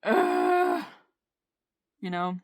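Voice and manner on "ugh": loud exasperated groan; frustrated groan. Real, not acted. Venting because it's so frustrating, loud groan.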